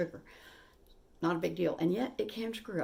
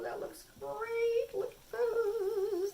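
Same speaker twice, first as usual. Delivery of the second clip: delighted cooing; much slower than usual. Real, not acted. Admiring fresh-baked pastries.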